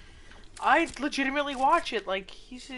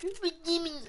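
lightly voiced goofy friend, a bit higher; silly pitched-up imitation of a teammate; mock Jack voice; 8 semitones higher than usual. Mocking a teammate's nagging about teaming, goofy higher voice. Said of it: "Jack sounds nothing like that".

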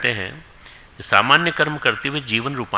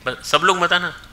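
raised voice, stopping the crowd from coming up; calling out; 7 semitones higher, 5 dB louder than usual. Real, not acted. Speaker to the crowd, calling out loud to stop them. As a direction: call out over the hall, half laughing.

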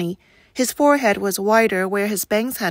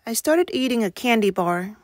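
usual speaking voice

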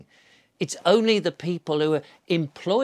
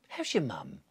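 much softer, lower, gently asked; a quiet, gentle greeting to a uniformed official; soft friendly ask; 6 semitones lower, 11 dB quieter than usual. Retold or imitated. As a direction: murmur it kindly. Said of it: "and saying".

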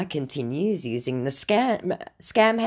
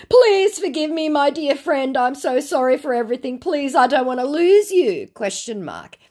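high, pleading mock scammer; 8 semitones higher than usual. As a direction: ham up the wheedling scammer. Scammer Eric's chat read out.

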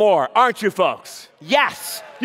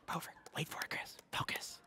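tense hushed whisper; suspenseful stage whisper before the throw; 5 semitones lower, 23 dB quieter than usual. Real, not acted.

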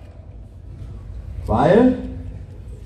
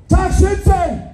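shouted greeting; 11 semitones higher, slower than usual. Real, not acted. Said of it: "Ich bin schwerhörig".

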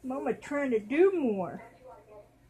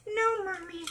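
put-on higher little child answering mommy; baby's voice; 8 semitones higher than usual. Baby doll answering mom about the binky, childish. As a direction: play the toddler who lost it.

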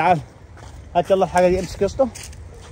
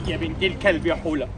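deep gruff man voice; 11 semitones lower, 9 dB quieter than usual. Acted. Abu el-Zir character curses the mother.

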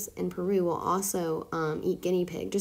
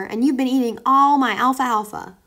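loud indignant farmer; farmer crying out; 5 semitones higher, 8 dB louder than usual. A narrator's reading. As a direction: cry out at the sneaky guinea pig. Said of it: "cried the farmer".

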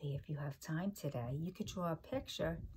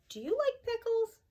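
eager question; 4 dB louder than usual. Real, not acted. Teacher asks children eagerly.

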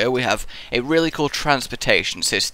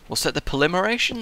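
puzzled; 6 semitones higher, 3 dB quieter, faster than usual. Shown as a demonstration.

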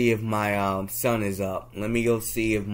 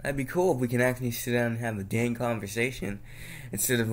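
normal talking voice

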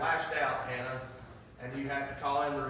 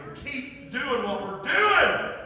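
preaching emphasis; 7 semitones higher, 7 dB louder, much slower than usual. Real, not acted.

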